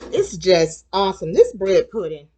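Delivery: usual speaking voice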